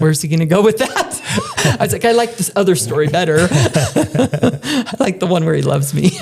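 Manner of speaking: amused, laughter breaking in; laughing while talking. Real, not acted. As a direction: tell it through fits of laughter. Both laughing at retold remark.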